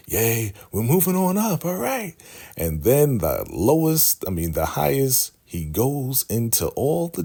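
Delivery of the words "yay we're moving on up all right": very high-pitched celebrating crowd; happy mock cheering. Happy followers repeating the Highest's prophecy, cheering. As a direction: squeal along like delighted followers. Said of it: "all happy".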